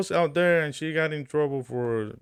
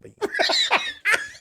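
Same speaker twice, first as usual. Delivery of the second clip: high burst of laughter; 7 dB louder than usual. Real, not acted. Laughs at joke.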